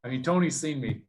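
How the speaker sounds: ordinary talk, nothing marked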